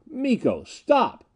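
high cry leaping up on the last word. A narrator's reading. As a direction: shout it desperately, pitch soaring. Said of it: "I called louder".

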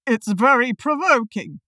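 affronted Humpty Dumpty. A narrator's reading. Humpty complains of being called an egg, affronted. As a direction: play an affronted egg creature. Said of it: "Humpty Dumpty said after a long silence".

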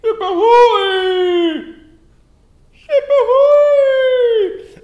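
shouting from crow's nest. Acted. Lookout calls from crow's nest.

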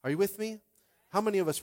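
ordinary talk, nothing marked

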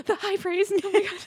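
very high, squeaky gush; mock overwhelmed. Viewer reacting to the dad's approval, high hammed-up wail. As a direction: squeal it as if close to tears. Viewer overcome by father's praise.

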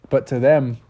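plain reading voice

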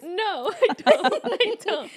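laughing through the words. Real, not acted. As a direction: giggle out the answer. Laughing at question.

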